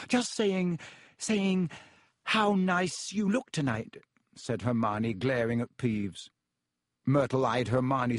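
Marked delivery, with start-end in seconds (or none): 0.1-4.0 flustered. Acted. Caught out, flustered covering.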